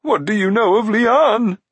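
high, loud quavering demand; urgent giant's question. A narrator's reading. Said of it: "Glue quickly asked".

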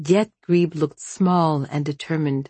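ordinary delivery, nothing marked

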